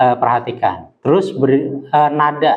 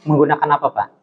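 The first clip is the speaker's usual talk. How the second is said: ordinary delivery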